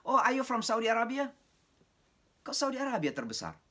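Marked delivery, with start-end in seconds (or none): 0.1-1.3 high, loud mock call from the crowd; retold student shout. A student shouting a wrong guess at the lecturer, mock loud call. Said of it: "Ada yang teriak".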